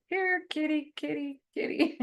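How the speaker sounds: lilting high call for a kitty; sing-song here-kitty call; calling a cat. Pretend. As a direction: coax the cat in from afar.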